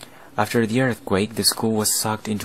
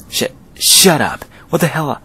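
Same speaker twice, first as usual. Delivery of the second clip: louder stammered shut up; panicked snap; 5 semitones higher, 5 dB louder than usual. Acted. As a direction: cut them off in a panic. Bully panics at curse talk.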